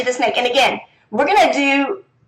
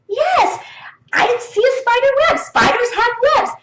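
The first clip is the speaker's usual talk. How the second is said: put-on much higher, eager child reply; child's answer; 12 semitones higher than usual. Shown as a demonstration. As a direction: answer like a proud kid.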